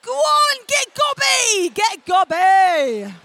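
loud, high goading call; rowdy egging on. Real, not acted. Speaker urges crowd to get gobby, loud goading. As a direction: urge them on, stretching the last words.

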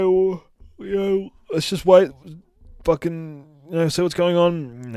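a lightly voiced Leo urging everyone to wait; hammed-up Leonardo.

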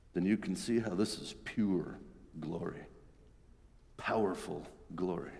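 hushed, earnest reassurance; quiet conviction. Acted. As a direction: draw him in softly. Jesus explaining quietly to Peter.

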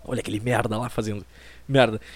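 a hint of disappointed parents grumbling about their son. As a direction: mutter it like parents sighing over their son. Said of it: "Eles devem acordar todos os dias e pensar".

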